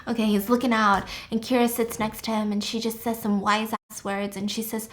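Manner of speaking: ordinary talk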